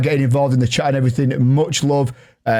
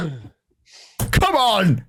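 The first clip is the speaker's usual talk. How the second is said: mock disbelief; 5 semitones higher, much slower than usual. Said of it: "I go".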